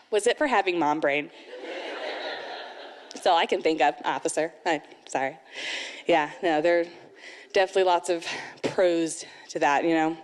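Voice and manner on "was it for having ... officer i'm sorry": low, deflated confession to an officer; meek, low excuse fading softer; sheepish mock apology. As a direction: own up lamely, voice sinking.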